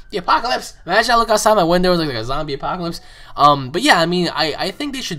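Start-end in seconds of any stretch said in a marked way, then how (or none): 0.1-0.8 higher exclaim of apocalypse; half-joking doom announcement; mock alarm. Real, not acted. Cops outside, exclaims. 0.9-3.0 fast, louder excited telling; hyped-up fast aside; excited rush. Real, not acted. Excited aside about cops.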